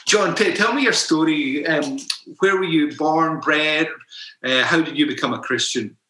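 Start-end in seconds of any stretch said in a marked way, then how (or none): none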